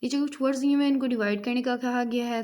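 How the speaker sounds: ordinary talk, nothing marked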